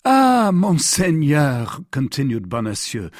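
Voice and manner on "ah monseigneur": slow, high, louder cry of adoration; fawning exclamation. A narrator's reading. Prisoner at his Eminence's feet, fawning exclamation. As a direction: exclaim it in drawn-out reverence. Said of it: "throwing himself at his Eminence's feet".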